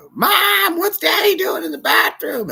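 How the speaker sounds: put-on high, loud screaming kid; son's high yell; child screaming. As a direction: shriek it like a shocked little boy. Son screaming in bathroom scene.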